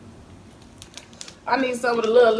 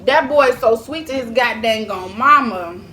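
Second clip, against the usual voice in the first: doting; 3 semitones higher, 8 dB louder than usual. Real, not acted. Doting on baby son.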